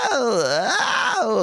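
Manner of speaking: creaky high vocal fry slide; fry through the whole range. Shown as a demonstration. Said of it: "I'm vocal frying all the way through my range".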